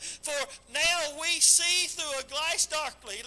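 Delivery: slow, weighty reading of the verse; slow scripture reading. Acted. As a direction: read it out slow and solemn, like from the pulpit.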